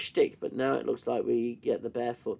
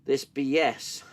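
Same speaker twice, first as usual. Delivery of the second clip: usual speaking voice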